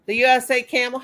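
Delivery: normal talking voice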